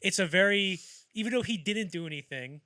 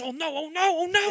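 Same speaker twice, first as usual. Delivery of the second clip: mock panicked wail, much higher and louder; freaking out; 14 semitones higher, 10 dB louder than usual. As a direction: imitate Marty hitting the panic button. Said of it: "He's just freaking out".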